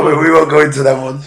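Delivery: normal talking voice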